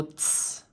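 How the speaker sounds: ts as one voiceless hissed sound. Shown as a demonstration. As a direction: make ts a bare T released into S, no vowel. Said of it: "this is a T S sound".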